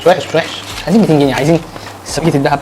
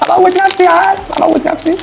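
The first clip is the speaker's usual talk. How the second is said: mock despair; 13 semitones higher than usual. Real, not acted.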